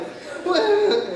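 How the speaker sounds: high squeal of laughter. Real, not acted. Recalling losing his voice, high laugh.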